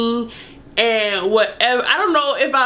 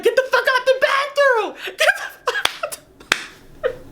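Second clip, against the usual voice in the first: high shrill yell through laughter; laughing angry yell; 14 semitones higher, faster than usual. Retold or imitated. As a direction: scream it while cracking up. Screaming at partner, laughing.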